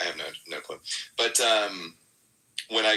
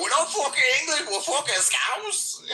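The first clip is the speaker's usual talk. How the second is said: Scouse shout. Retold or imitated. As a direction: yell it like drunk Scousers in a bar, Scouse accent. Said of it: "they kept screaming that in my phone".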